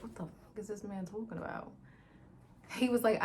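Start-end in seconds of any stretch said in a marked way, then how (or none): 0.0-1.7 quiet disbelief. Real, not acted. Confused aside.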